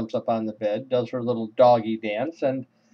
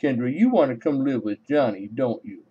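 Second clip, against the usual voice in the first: man-talking-to-dog voice. Acted. Man reassuring little Kendra she is safe, dog-talk voice. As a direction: coo it like a man babying his puppy. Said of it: "my man talking to dog voice".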